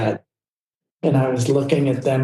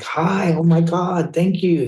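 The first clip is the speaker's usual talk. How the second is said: lightly put-on British accent, pitched higher; hint of an excited British photographer, voice lifted; 6 semitones higher than usual. Photographer gushes hello to the new makeup artist, lightly imitated British accent. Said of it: "He's this British photographer".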